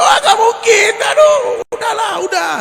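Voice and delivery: high, loud exasperated shout; frogs yelling. Retold or imitated. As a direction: yell at the frog to give up. Frogs yelling at jumping frog. (teriakan kodok yang putus asa, tinggi dan keras)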